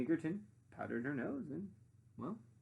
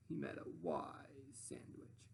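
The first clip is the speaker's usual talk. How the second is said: whisper; 8 semitones lower, 9 dB quieter, slower than usual. Acted. Whispered in story.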